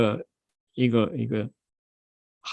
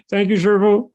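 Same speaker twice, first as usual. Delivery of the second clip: grateful disciple; 7 semitones higher, 4 dB louder than usual. Acted. Disciple thanks his master.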